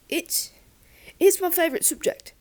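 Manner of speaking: high, loud, eager blurt; hot rush. Acted. Shy pupil blurting praise to his teacher, in a hot rush. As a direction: gush it out before you lose nerve. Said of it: "all in a hot rush".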